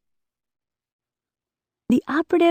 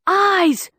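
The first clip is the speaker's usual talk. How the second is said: excited cry; 10 semitones higher than usual. Acted. Bex shouts excitedly into the earpiece to her friends. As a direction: burst out with the big news. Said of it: "as Bex cried".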